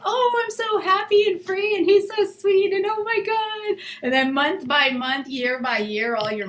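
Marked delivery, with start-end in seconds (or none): 0.0-3.8 high gushing lovestruck voice, much higher; new couple in love, giddy high gush; giddy new lover. Retold or imitated. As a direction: gush like a smitten new girlfriend. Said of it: "at first you're just like".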